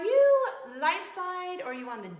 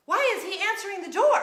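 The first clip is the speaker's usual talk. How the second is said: high, loud, quick question; retold panicked bewilderment; shocked disbelief; 8 semitones higher, 7 dB louder, faster than usual. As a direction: blurt it out in alarm. Reenacting shock at neighbour appearing.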